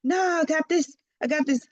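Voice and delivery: high, breezy mock confidence; cocky dismissal. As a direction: wave it off like you've got it handled. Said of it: "you're like".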